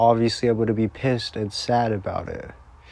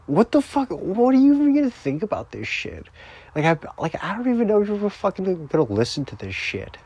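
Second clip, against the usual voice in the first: high, fast, agitated rant; exasperated outburst; 6 semitones higher, faster than usual. Real, not acted. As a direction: burst out, rushing and upset. Sudden outburst at the absent listener.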